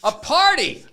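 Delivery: hammed-up angry outburst; mock rant. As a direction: blow up at the book. Ranting then exploding at book.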